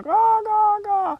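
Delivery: high cawing crow call. Retold or imitated. Storyteller mimics the crow in the tree, high cawing. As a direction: caw like an old crow up in a tree. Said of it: "the old crow up in the tree going".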